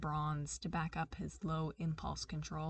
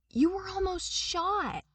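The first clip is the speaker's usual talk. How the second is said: much higher and louder, distressed; alarmed girlfriend voice; sharp worried outcry; upset; 13 semitones higher, 7 dB louder than usual. Acted. Darcy upset at injured girlfriend.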